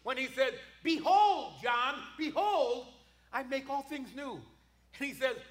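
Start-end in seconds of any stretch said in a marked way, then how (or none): 0.8-4.4 loud, slow proclamation of behold; proclaiming. Acted. Preacher booming God's words to John. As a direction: ring out behold like a herald.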